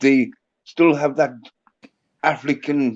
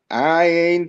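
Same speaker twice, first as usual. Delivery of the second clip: mock Southern drawl, pitched up; hammed-up Southern accent; imitating a Southern drawl to friends; 4 semitones higher, 4 dB louder than usual. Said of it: "you know the Southern drawl?".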